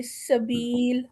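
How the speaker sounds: سبیل slow, with a thin hissing seen; thin whistled seen. Shown as a demonstration. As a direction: let سبیل whistle lightly on the s. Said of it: "سین کی آواز باریک ہوتی ہے اور سیٹی والی".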